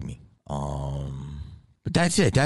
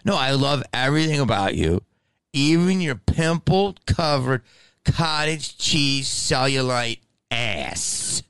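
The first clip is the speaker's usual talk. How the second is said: higher, slow, syrupy fake-adoring voice; mock sweet comeback; 6 semitones higher, slower than usual. Mocking a rude fan with fake affection, high and slow. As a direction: coo "pimpled, covered cottage cheese cellulite ass" like a sugary compliment. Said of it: "That's what I wanna say back to people".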